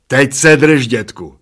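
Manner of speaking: loud warning call, dropping off. A narrator's reading. Bald man to man on his shoulders, calling out. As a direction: call out to hold on.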